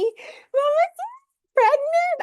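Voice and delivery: very high and loud, squealing with joy; thrilled. Real, not acted. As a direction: read the news out, bursting with joy. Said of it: "I'm trying to contain myself".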